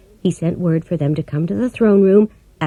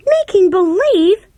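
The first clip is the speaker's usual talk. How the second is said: much higher and louder, slowed in disbelief; surprised cry; 13 semitones higher, 4 dB louder, slower than usual. A narrator's reading. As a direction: echo it in shocked disbelief. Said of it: "cried Dorothy".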